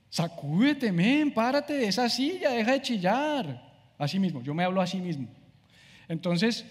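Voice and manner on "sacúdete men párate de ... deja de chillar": higher, slow commands to himself; a hint of a stern coach, higher and slower; shake-up command. Ordering himself out of the chair, higher and slow. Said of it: "a veces me toca sacudirme".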